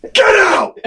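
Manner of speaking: high explosive yell; mock furious shout. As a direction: imitate an enraged outburst. Manager's furious outburst retold.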